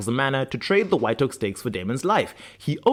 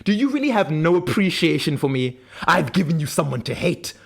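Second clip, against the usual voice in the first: hammed-up, self-righteous tirade in a raised pitch; Klaus, delulu rant; 6 semitones higher than usual. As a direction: build a mock-wounded rant, climbing high at the end. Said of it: "slips back into one of his Delulu rants".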